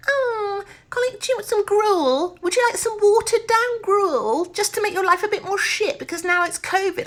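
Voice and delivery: hammed-up Oliver Twist workhouse whine; mock Dickensian hostess. Air hostess offering passengers gruel, hammed-up falsetto. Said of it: "bloody Oliver Twist".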